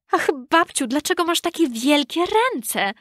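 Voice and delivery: put-on high, mildly alarmed child voice; frightened little girl. A narrator's reading. Scared girl questions disguised wolf.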